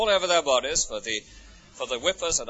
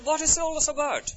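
much higher pitch, earnest question; urgent questioning; 14 semitones higher than usual. Retold or imitated. Church visitor asking the preacher in earnest, high and urgent. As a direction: ask it high, wanting to know.

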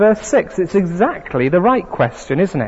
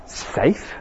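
breathy disbelieving whisper; incredulous whisper; 5 dB quieter than usual. A narrator's reading. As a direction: scoff at the word in a hush. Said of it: "said Mister Beaver".

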